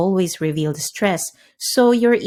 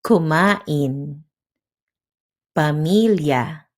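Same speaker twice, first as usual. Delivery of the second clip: "Kumain, Pamilya" in steady, balanced beats; clear, even syllables; 2 semitones lower, slower than usual. Shown as a demonstration. Teacher modelling rhythm, "Kumain, Pamilya" said clearly and evenly. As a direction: give every syllable of "Kumain, Pamilya" its time. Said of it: "Say each part clearly and evenly".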